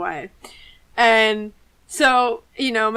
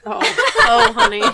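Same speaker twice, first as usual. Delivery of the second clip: laughing sympathy; 12 semitones higher, 4 dB louder than usual. Real, not acted. Sympathizing over crying story.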